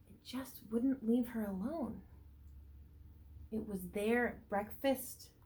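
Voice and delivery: slow, expressive storybook reading; reading to children. A narrator's reading. Teacher reading a picture book to the class, lively storybook voice. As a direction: read it out to a class of little ones.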